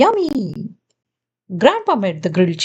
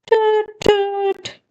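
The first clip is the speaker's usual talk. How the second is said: high playful toot toot; boat horn toot; 9 semitones higher than usual. Acted. Captain answering with a high horn toot.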